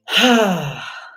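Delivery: big loud exhaling sigh. Shown as a demonstration. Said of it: "exhale with a sigh ... louder".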